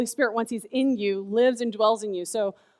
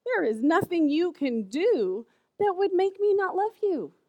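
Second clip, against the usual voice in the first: reassuring mom; 6 semitones higher than usual. Retold or imitated. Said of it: "I said".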